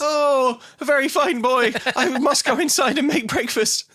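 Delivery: high strained fake-polite voice; forced politeness. Wife forced to praise the boy, strained politeness. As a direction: squeeze out the compliment through a pinched high voice.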